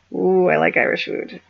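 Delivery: "Ooh, I like Irish food" crooned in a put-on voice; playful cooing. Vlogger joking about Irish food, playful put-on voice.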